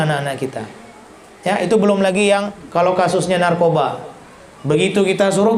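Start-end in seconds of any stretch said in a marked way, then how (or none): none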